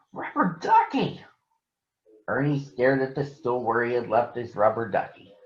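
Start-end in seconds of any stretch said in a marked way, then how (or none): 0.1-1.2 a sudden high call of alarm; alarmed cry. Acted. Ernie realising his duck is gone, alarmed cry. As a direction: cry out the duck's name in shock.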